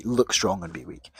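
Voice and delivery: ordinary talk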